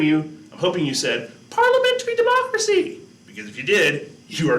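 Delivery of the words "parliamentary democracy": squeaky falsetto answer, much higher; playful high falsetto quiz answer; high-pitched voice. Pretend. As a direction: chirp the answer way up high like a cartoon kid. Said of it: "say that in a really high-pitched voice".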